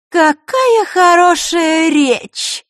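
very slow, high, loud sarcastic applause; mock praise. A narrator's reading. Marishka pretends to applaud Vasilisa's speech, loudly sarcastic. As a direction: stretch every word in sneering admiration. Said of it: "Маришка сделала вид, что хлопает".

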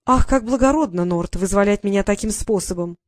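drip sarcasm at him; sarcastic. Acted. Sister answers brother's kick at door, loud sarcasm.

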